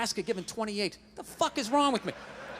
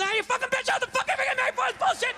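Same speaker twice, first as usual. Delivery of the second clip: much higher, fast hammed-up outburst; mock dad snapping; 12 semitones higher, faster than usual. Dad snapping at dinner table, hammed-up rage. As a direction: explode out of nowhere. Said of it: "he would just snap".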